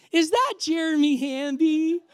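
high, slow, loud sweet-lady call. Retold or imitated. Woman calling from car window to runner, sweet voice. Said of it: "this sweet voice goes".

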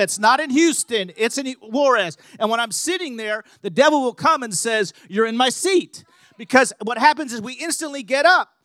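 loud, driving sermon delivery, punching each line; preaching at full force. Acted. Preacher rousing the church about protecting their seed, loud and driving. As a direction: preach it hard to stir up the congregation.

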